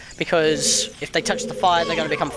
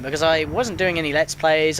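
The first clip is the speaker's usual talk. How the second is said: normal talking voice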